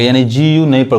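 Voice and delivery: ordinary talk, nothing marked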